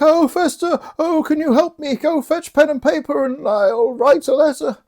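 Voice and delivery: very high, pleading wail; high-pitched mock imploring; Malvolio. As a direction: beg in a hammed-up desperate voice. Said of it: "Malvolio's like".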